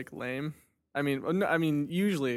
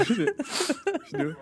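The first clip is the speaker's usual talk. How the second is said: ordinary delivery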